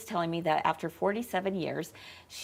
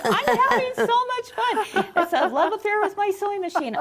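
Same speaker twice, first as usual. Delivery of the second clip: high, bubbly imitation of a delighted woman; friend's delight quoted in a high voice; gleeful older lady; 12 semitones higher, 6 dB louder than usual. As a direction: play her giddy over the sewing machine. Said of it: "And she's like".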